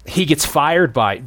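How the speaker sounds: normal talking voice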